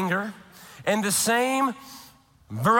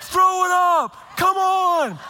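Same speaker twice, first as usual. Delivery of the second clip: husband yelling at wife to make herself vomit, frantic; 9 semitones higher, slower than usual. Retold or imitated. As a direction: yell at her to throw it up.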